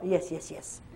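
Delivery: quoting the patient's hesitant yes, half laughing; hint of the man's English yes; imitating the man. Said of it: "Il dit encore".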